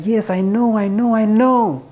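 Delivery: a scorpion admitting it can't help itself; scorpion's sheepish excuse. Retold or imitated. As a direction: play the scorpion caught out but unrepentant. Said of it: "The scorpion's like".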